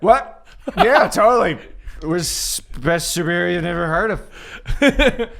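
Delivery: laughing Booster. Acted. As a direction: blurt it out through laughter. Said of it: "Booster's like".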